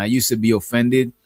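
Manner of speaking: ordinary delivery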